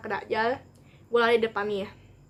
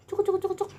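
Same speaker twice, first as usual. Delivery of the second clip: mock catcall clicks; 9 semitones higher than usual. Imitating catcalls on the road.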